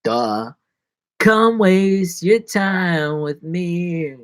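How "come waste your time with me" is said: slow drawn invitation. Real, not acted.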